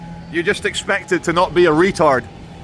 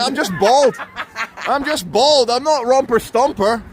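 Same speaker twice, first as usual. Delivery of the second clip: high, exasperated mock protest; 9 semitones higher than usual. Answering commenters about his skinhead, high-pitched mock protest.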